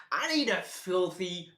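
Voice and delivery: ordinary delivery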